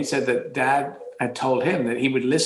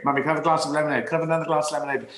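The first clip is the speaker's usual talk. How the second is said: put-on rapid-fire child begging for drinks; pleading child; 3 semitones higher, much faster than usual. Child begging Mummy for lemonade, fast pleading. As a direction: rattle it off like a sugar-mad kid. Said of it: "I was fixated with sugary drinks".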